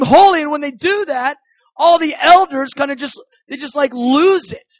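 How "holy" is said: quick high burst of praise; overwhelmed proclamation. Acted. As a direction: cry it out loud in overwhelmed worship. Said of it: "they begin to proclaim".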